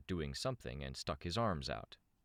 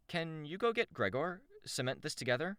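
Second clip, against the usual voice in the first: much higher character voice; lively female character; 13 semitones higher than usual. Acted. Shamrock asked to fetch Gregor, high character voice. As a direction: pitch it up for a chirpy request.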